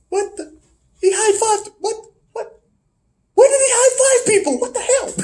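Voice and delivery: high-pitched incredulous sputter; mock bewilderment. Baffled that a mad dog high fives, mock disbelief. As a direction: squeak in disbelief, breaking toward laughter.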